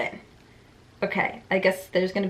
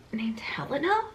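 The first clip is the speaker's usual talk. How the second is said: whisper; 4 semitones higher, 6 dB quieter than usual. Real, not acted. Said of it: "whispered disbelief".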